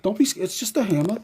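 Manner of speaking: high puppy talk. Real, not acted. Soothing the dog.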